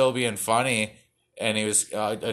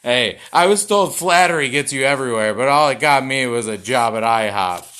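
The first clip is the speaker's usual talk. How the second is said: host one-liner; 8 dB louder than usual. Acted. Comic host delivering a one-liner.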